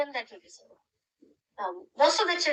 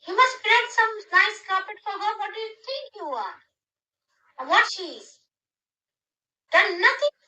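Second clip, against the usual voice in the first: higher, louder mock indignation at the missing carpet; indignant retold scolding; 9 semitones higher, 4 dB louder than usual. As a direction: scold on his behalf, pitched up. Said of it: "He said".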